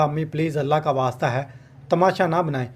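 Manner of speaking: ordinary delivery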